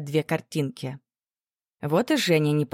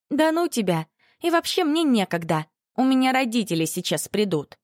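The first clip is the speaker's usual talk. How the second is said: high, huffy brush-off; offended; 6 semitones higher than usual. A narrator's reading. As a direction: snap back, offended. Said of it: "обиженно заявила одноклассница".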